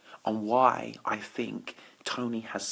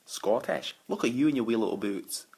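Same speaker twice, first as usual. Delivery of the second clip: mock Scottish accent. Teasing Scottish viewers on the live, in a playful Scottish accent. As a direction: roll into a cheeky Scottish voice. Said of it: "[name], perfect accent".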